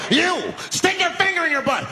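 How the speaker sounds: high, yelped command; demanding madman. Acted. Homeless man to the next terrorized passenger, high crazed demand. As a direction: bark the order out high. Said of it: "he starts making demands".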